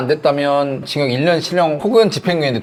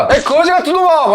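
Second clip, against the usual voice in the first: high furious shout; angry yelling; 12 semitones higher, 4 dB louder than usual. Retold or imitated. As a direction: scream insults while dragged off. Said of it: "하면서 그렇게 가는". (날카로운 분노의 고함)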